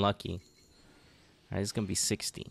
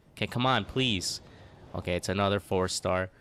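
ordinary talk

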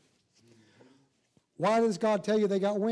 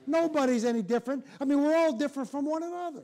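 loud, high fervent preaching; preaching at full force; 6 semitones higher, 5 dB louder than usual. Acted. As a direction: drive it home from the pulpit.

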